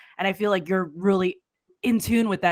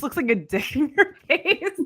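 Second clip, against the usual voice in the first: laughing; 8 semitones higher than usual. Real, not acted. Joking about the mic.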